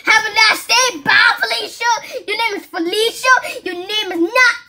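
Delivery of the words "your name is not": normal talking voice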